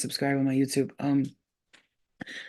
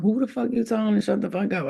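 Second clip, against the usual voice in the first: retold mad comeback, fast and higher; mad retort; 5 semitones higher, faster than usual. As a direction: fire back quickly in mock outrage. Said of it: "I started talking like that".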